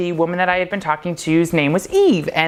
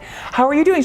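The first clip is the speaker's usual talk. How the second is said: higher, bright friendly hello; cheery greeting; 10 semitones higher than usual. Retold or imitated. Greeting Eve at the counter, cheery and high. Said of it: "I'd be like".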